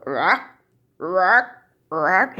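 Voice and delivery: short, imitated burp noises; mock burp. Shown as a demonstration. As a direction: fake three short croaky burps. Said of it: "Eructar es esto".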